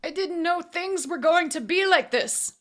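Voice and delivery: high, loud panicked cry. A narrator's reading. Auctioneer grabbed by the assassin, crying out. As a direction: cry out in desperate excuse. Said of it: "Moxie cried out".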